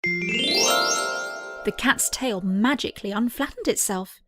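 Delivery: ordinary delivery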